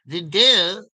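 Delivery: the door in a hammed-up Inspector Clouseau accent. Shown as a demonstration. Imitating Clouseau to friends, mock French accent. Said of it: "talk a little bit Clouseau".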